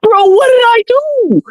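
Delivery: squeaky high bewildered plea; high confused whine. Retold or imitated. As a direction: pitch it way up in disbelief. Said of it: "I was like".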